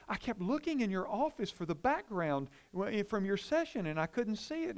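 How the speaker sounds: a hint of an eager young woman; quoting the daughter. Visiting daughter quoted.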